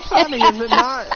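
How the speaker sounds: loud, high, gleeful taunt; laughing villain. Acted. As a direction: taunt loudly through crazed laughter. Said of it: "is just laughing".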